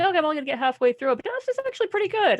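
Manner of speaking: pleased bedtime reading remark; quoting herself. Retold or imitated. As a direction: rattle it off quickly as a sleepy aside. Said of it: "went to bed last night saying".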